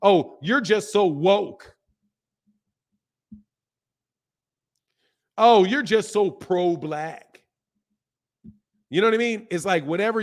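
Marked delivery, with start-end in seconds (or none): 0.0-7.4 drawn-out, mocking quote of critics; slow, higher-pitched mock complaint; mocking imitation. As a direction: parrot the accusation with exaggerated slowness. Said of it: "immediately start trying to weaponize it".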